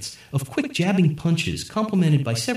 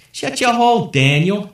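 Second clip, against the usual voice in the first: loud, high warning to his friend; warning snap; 8 semitones higher, 6 dB louder than usual. A narrator's reading. Nick warns Daniel to shut up, loud and high. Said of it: "Nick warned".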